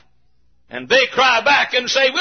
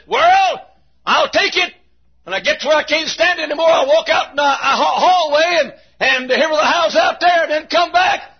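high, forceful preacher's cry; defiant shout back; 5 semitones higher than usual. Acted. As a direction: call it back across a great gulf. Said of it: "shouts back over the deep and she says".

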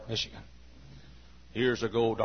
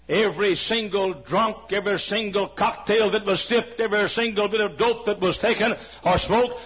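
rising higher and louder to a cry; building to a shout; 8 semitones higher, 5 dB louder than usual. Acted. Preacher building to a triumphant cry to the congregation. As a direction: build the list to a shouted climax.

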